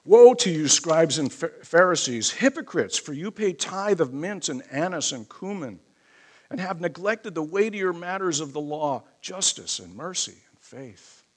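loud burst on the woe, then easing; forceful scripture preaching. Acted. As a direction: proclaim the rebuke from scripture.

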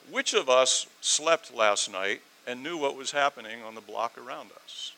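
ordinary talk, nothing marked

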